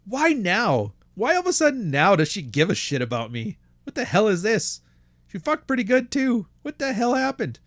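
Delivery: high-pitched, baffled retold questions; mock bafflement, voice pushed up high; imitated confusion. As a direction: act out a bewildered husband, pitch climbing. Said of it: "He was confused".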